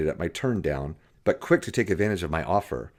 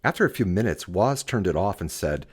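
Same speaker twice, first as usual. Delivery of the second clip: ordinary delivery, nothing marked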